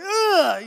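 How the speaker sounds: high, loud mock grimace of disgust; a big yucky ugh at bad food; hammed-up ugh of distaste. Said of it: "if you taste like".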